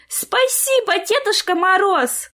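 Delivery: high-pitched squeal of thanks; gushing gratitude; delighted child. A narrator's reading. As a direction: thank Santa as an overjoyed little girl.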